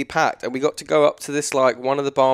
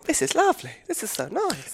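mocking posh voice; 5 dB quieter than usual. Mocking beachgoers enjoying it.

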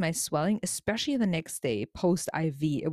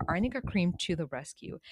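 ordinary delivery

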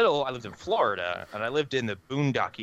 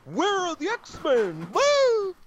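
high squealing cheer that jumps into a loud whoop; high, loud fanboy cry; excited fan; 5 dB louder, much slower than usual. Retold or imitated. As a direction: gush like an overeager superhero fan. Mimicking an excited Gifted fan.

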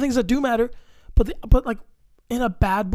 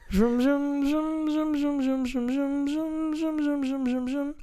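zoom sound effect; 5 semitones higher, 5 dB quieter than usual. Pretend. Sound effect after bad boys line.

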